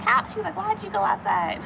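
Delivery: high, soft imitation of mom scolding a toddler; mild mock scolding. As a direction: chide a baby in a small high voice. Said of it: "got a little bit of scolding".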